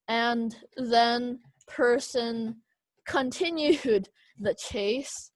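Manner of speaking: very slow, deliberate reading; slow dictation pace; slow read-through. Shown as a demonstration. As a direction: drag out each word as you read. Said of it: "you could say".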